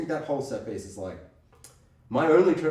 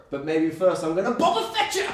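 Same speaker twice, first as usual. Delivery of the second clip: loud, laughing threat; gleeful battle cry; 4 semitones higher, 6 dB louder than usual. Retold or imitated. Mocking the marshal as Boba Fett yells with glee. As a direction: shout it through a laugh, relishing it. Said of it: "He's like".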